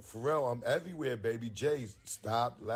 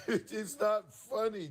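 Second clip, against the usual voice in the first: laughing through the words, slowed; 9 semitones higher, slower than usual. Real, not acted. Telling Jay it's not funny while laughing. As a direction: try not to laugh and fail.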